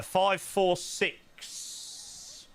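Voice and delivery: "five four six" drawn out very slowly, rising; slow read-out. Shown as a demonstration. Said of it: "three is what they do".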